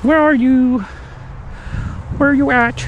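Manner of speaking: loud, high call across the woods; searching shout. Real, not acted. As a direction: call out for the escaped one. Calling out for lost critter.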